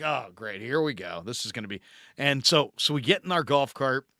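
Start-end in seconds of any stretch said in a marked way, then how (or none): 0.0-1.8 softer, lower deadpan dread; sarcastic resignation. Retold or imitated. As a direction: mutter it like you know trouble is coming. Resigned reply to "my dad's a member.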